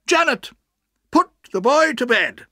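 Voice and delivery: high, loud command; aunt giving orders. A narrator's reading. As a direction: call out the order in a high, firm voice. Aunt calls an order to servant.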